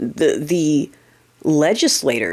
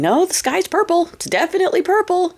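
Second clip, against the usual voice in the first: squeaky high insistent mock voice, much higher; mocking deniers; 12 semitones higher than usual. Mocking people insisting the sky is purple, in a high silly voice. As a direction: play the stubborn fool who swears the sky is purple.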